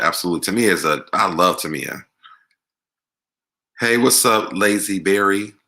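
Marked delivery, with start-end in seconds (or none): none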